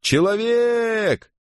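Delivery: very high, long-drawn sailor's call; high sustained cry of alarm. A narrator's reading. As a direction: stretch the word out high, as if hailing from far away. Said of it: "прокричал какой-то матрос".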